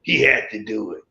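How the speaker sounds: lightly voiced onlookers, a little low; a hint of a low heckler voice; peanut gallery. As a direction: imitate hecklers chiming in from the side. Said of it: "the peanut gallery over here saying".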